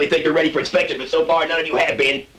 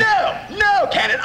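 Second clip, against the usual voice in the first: drill instructor shout; 11 semitones higher, slower than usual. Acted. Instructor interrupting candidate.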